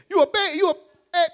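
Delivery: high, loud jeering taunt; mock insult. Mocking a wife insulting her husband, high and loud. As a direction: taunt him like a provoking wife. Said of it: "Call him a band".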